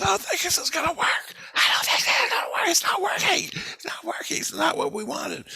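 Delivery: very high, strained falsetto shriek; mock a frantic girl screaming out that it's failing; imitated girl's screaming. Girl screaming to him across the tracks, imitated shrieking. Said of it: "She screams to me".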